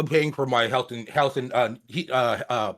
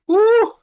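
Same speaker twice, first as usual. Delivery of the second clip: loud whoop; 7 dB louder than usual. Real, not acted. Reacting to hot beans.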